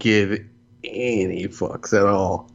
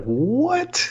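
surprised; 11 semitones higher than usual. Retold or imitated. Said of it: "I'm like".

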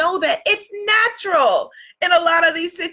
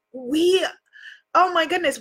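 normal talking voice

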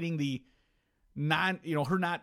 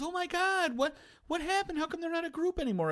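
high shrill mock-naive gasp, much higher; mocking the wife. Husband mimicking his wife's shocked question. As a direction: squeal it like a clueless wife.

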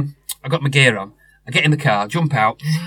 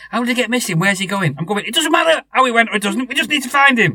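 stressed retelling; 9 semitones higher than usual. Retold or imitated. Owner retelling panicked calls with ex-wife, higher and stressed. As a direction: replay the frantic phone calls, pitch up. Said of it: "It was stressful".

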